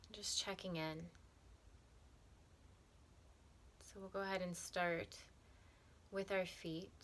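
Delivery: calm, softened meditation voice with long pauses; unhurried, even, soothing meditation guide; guided meditation. Acted. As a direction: guide them gently through breath and body, leaving space between phrases.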